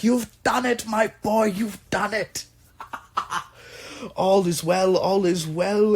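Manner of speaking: high-pitched, gleeful patron with a laugh; jubilant patron. Acted. Patron dancing round the inventor who finished it, jubilant. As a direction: celebrate in a high, overjoyed character voice.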